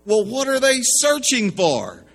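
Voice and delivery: mock panic at the child's answers; panicked. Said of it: "Now he's really upset".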